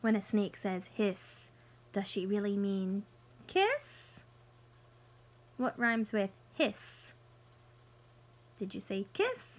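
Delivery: bright storybook read-aloud for little ones; picture book voice for kids; reading to children. Acted. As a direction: read it like story time on the rug.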